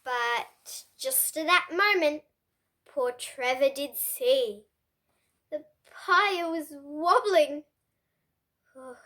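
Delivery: usual speaking voice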